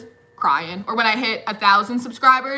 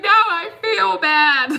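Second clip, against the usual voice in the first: slow whiny plea; 8 semitones higher, 5 dB louder, much slower than usual. Retold or imitated. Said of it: "I was like".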